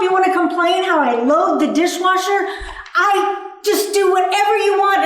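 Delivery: mock marital blow-up; angry rant. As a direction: fire back at the complaining partner. Ranting at partner over chores.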